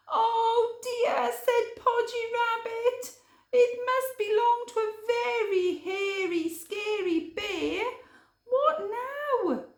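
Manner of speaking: a timid rabbit fretting about the bear; Podgy Rabbit voice. Acted. Podgy Rabbit fretting to Hare, high nervous voice.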